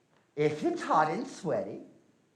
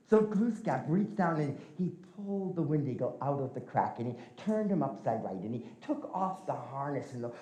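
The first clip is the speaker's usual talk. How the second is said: ordinary delivery, nothing marked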